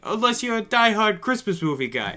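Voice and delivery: put-on loud wiseguy New York accent. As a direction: play a brash New Yorker. Jokey New York accent continues.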